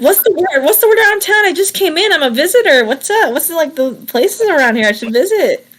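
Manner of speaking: high, chatty friendliness; chirpy newcomer. Acted. [name] acting friendly newcomer.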